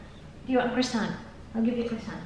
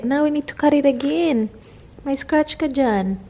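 usual speaking voice

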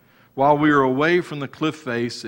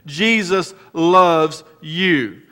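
slow declaration; 4 semitones higher, 5 dB louder, much slower than usual. Acted.

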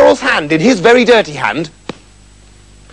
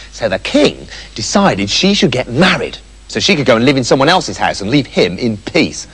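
ordinary delivery

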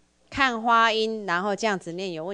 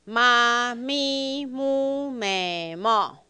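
syllable drill; 3 semitones higher, much slower than usual. Shown as a demonstration. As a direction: call each syllable out clearly for the class. (带读假名，每个音拉长念)

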